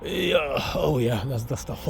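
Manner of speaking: soft, strained groan of relief; old-guy stretching groan. Shown as a demonstration. Old guy stretching his aching back, groaning with relief. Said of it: "Old guys, you can make sounds like this".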